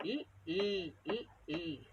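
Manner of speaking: short ई, then stretched ई; teacher drilling short against long ई; short-long vowel drill. Shown as a demonstration. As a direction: alternate a light, brief ई with a long ई. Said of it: "वजन देंगे और लंबा खींचेंगे".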